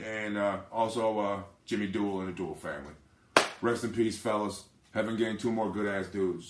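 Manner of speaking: quietly honour the dead friends; solemn tribute. Real, not acted. Paying respects to friends who died.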